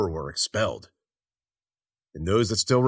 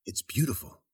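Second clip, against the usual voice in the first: soft, marvelling murmur; hushed awe; 7 dB quieter than usual. A narrator's reading. Mahu gazing at the amber light, hushed wonder. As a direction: say it softly, entranced. Awed gaze at glowing box.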